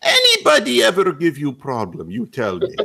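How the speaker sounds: put-on Russian tough guy accent. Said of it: "he goes".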